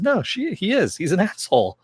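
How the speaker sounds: high, laughing through the words; laughing insistence. Retold or imitated. Tulip insisting God's an asshole.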